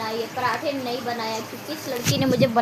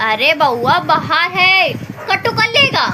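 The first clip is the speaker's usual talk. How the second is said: mock scary warning; 3 semitones higher, 10 dB louder, slower than usual. Real, not acted. Mother warning her toddler the dog outside will bite, mock scare.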